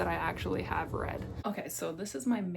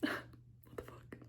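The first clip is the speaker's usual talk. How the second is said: whispered shock; 8 dB quieter, slower than usual. Real, not acted.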